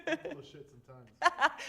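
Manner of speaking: slow, loud playful admission; loud slow joking call. Real, not acted. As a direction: drawl it out, loud and teasing. Student jokingly confesses to the group.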